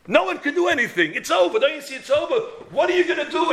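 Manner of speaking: high, urgent protest; retold despairing challenge. Crowd telling Pinchas it is over, despairing challenge.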